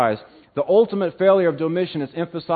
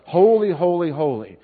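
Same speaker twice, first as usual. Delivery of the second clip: first HOLY raised and louder, last one settling; emphatic doxology; 3 semitones higher, 4 dB louder than usual. Retold or imitated. Preacher showing the congregation God's greatness, emphatic. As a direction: proclaim HOLY high, then ease down through the repeats. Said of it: "emphasizes the greatness of God".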